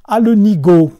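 loud scolding wife; wife shouting insults at her husband; angry shout. Acted. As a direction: yell at the foolish husband. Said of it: "s'écria la femme".

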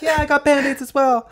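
loud, very high squeal; mock frantic reply. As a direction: squeal back as if in re-enacted chaos. Re-enacts own frantic reply.

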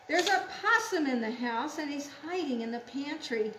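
quick, urgent picture-book alarm; excited story reading. Acted. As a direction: announce the intruder in a hurry.

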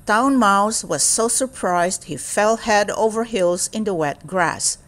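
ordinary delivery

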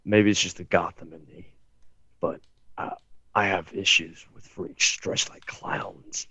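hushed, slow movie-character quote; Bruce Wayne quote. Retold or imitated. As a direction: quote him softly, drawing out the end. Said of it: "I'll quote him".

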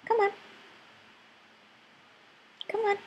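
ordinary talk, nothing marked

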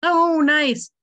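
high, loud delighted praise; light up with delight. Real, not acted. Delighted at card plan.

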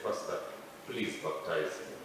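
quiet, slow plea; hushed pleading, slowed down; retold whispered plea to the pastor; soft begging. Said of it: "I kept on whispering".

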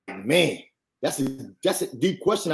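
hushed, low aside to himself; quietly pondering. Retold or imitated. Young man pondering the pastor's question, quietly. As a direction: take it in, softly and low.

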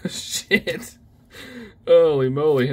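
giddy laughing curse. Real, not acted. Giddy after big cards.

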